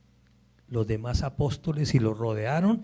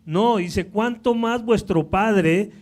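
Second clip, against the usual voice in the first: loud, high, impassioned preaching; preaching at full force; 6 semitones higher, 7 dB louder than usual. Acted. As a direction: drive the point home with fire. Predica con toda la fuerza. (predicación fervorosa a todo pulmón)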